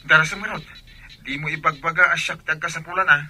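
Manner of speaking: impatient push. Acted. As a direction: press him hard and loud. Friend pressing for a loan.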